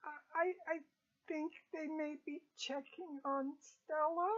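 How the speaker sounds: nervous servant choosing words carefully; timid cook. Acted. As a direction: hesitantly let it out, rising as if unsure. Cook hesitates to reveal where they are.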